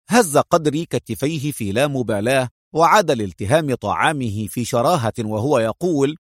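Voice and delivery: plain reading voice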